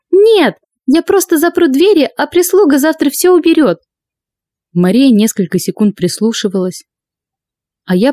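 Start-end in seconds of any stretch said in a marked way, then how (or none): 0.1-3.8 nervous lady of the house; high, tense voice covering fear; high anxious woman. Acted. Maria's voice held.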